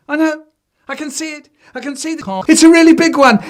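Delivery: put-on high, eager child voice; excited child. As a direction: point it out excitedly.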